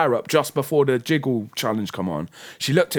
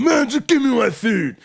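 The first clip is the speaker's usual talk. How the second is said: mock drunk brush-off; 10 semitones higher, 4 dB louder than usual. Drunk friend waving him off.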